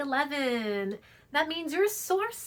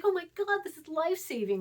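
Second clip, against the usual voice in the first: gushing relief; 7 semitones higher than usual. Retold or imitated. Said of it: "She's like".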